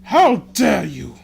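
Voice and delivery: high, loud, slow outraged cry; enraged cry. Acted. Killer crying out in rage before stabbing. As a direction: spit each word slowly in fury. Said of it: "he cried".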